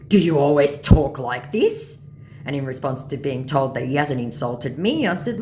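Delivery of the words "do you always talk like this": loud, slow scammer question; questioning mock scammer. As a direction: draw it out, rising at the end.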